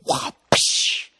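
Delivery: whooshing cane swing and crack; wah, then a sharp rush of air; imitated cane strike. Comic imitating the caning, mouth-made swish and crack. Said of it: "this guy just goes fucking".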